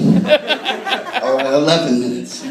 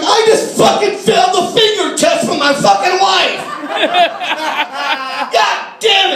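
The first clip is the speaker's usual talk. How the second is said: much higher panicked shout; panicked yell; 11 semitones higher than usual. Retold or imitated. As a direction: scream it like you're freaking out. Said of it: "I'm freaking out".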